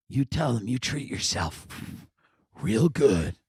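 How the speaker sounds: low madam voice. Retold or imitated. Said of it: "she had the energy of a madam".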